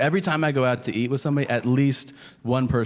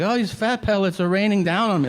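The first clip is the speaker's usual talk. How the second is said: higher, whiny mock lament; mock dramatic complaint; 9 semitones higher than usual. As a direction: pretend fat falls like rain. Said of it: "where I'm like".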